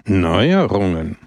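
drawn-out, pointed single word; pointed remark. A narrator's reading. Brusco to Pepone about the moved candlestick, pointedly. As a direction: stretch the word out slowly, pointedly.

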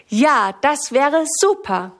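slow, lifted, happily drawn-out cheer; a touch of glee, pitch rising; delighted. A narrator's reading. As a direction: voice a child's happy yes, unhurried. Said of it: "freut sich Sammy".

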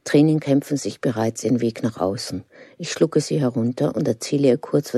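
ordinary delivery

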